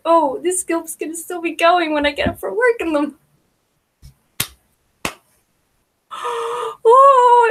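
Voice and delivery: gasp, then a high loud oh; gasp of delight. Real, not acted. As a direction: react like the gossip is too good. Gasping at the drama.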